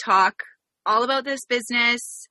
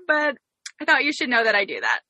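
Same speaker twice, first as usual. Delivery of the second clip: ordinary talk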